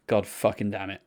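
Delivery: low, hushed inner cursing; muttered self-reproach. Retold or imitated. Fan kicking himself for the rest of the gig, muttering. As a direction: retell a regretful thought under your breath. Retold regretful thought after the meeting.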